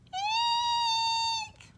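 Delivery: very high shrill eek; shriek. A narrator's reading. Hugly scared by the ghost, very high shriek. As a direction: shriek in fright. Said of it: "Hugly cried".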